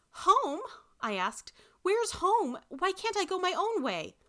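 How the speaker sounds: high, protesting questions; indignant questioning. A narrator's reading. Granddaughter protests being carried home, high and indignant. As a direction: question it in high-pitched alarm.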